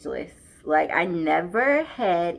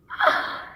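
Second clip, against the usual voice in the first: sharp breathless gasp of delight; quick surprised intake of breath; excited gasp. Real, not acted. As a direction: gasp at what's inside. Opening the box.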